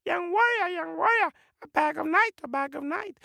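very high chattering bird; tiny high-pitched jungle bird calling out; squawking bird voice. Acted. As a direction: repeat it like a parrot. Birds chatter to warrior again.

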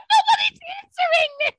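high, loud near-crying wail; frantic half-sobbing complaint; panicked whine. Retold or imitated. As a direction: wail it like you're about to cry. Frantic DMs to nurse.

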